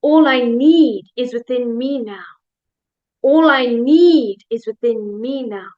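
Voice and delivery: "need" swelled loud and drawn out; slow affirmation leaning hard on "need"; stress on NEED. Shown as a demonstration. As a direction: lift and stretch the word "need". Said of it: "We're gonna change the emphasis".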